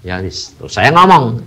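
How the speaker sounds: usual speaking voice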